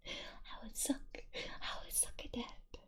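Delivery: hushed correction after a slip; whisper. Real, not acted. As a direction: catch yourself and drop back to a whisper on "I would suck". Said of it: "Oop, sorry".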